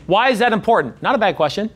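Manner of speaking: ordinary delivery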